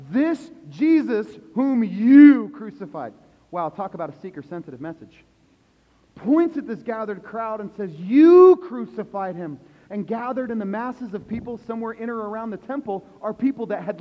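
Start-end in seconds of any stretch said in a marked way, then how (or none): 0.1-3.1 loud, slow, hammering accusation; preacher thundering the charge; accusing shout. Acted. Peter accusing the crowd. 7.9-9.6 loud and slow, punching each word; accusing shout at the crowd; pointed accusation. Acted.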